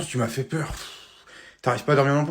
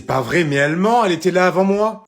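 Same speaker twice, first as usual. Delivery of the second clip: louder, faster indignant protest; protesting kid; 4 semitones higher, 7 dB louder than usual. Acted. Son protests that mum is lying, indignant. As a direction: defend yourself, outraged.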